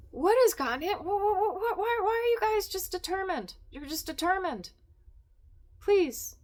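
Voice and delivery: real loud fed-up whine; exasperated yell. Real, not acted. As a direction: plead with the misbehaving cats. Exasperated with the cats.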